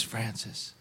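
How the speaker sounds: soft, hushed name in the ear; whispered name. Retold or imitated. Stranger whispering into his ear during worship, hushed. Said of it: "He just whispered that in my ear".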